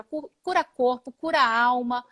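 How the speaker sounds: usual speaking voice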